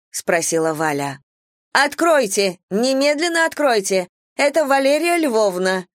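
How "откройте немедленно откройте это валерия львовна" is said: shrill voice through a door. A narrator's reading. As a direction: shriek it from behind the door. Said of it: "раздался из-за двери визгливый голос".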